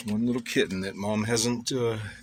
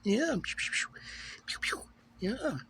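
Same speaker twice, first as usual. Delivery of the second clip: pet talk; 6 semitones higher than usual. Real, not acted. Cooing to cat about mouse.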